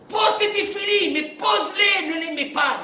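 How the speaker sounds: loud, high preaching shout; shouted exhortation. Real, not acted. Rabbi exhorting the congregation, loud high shouted preaching. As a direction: hammer it home at full force.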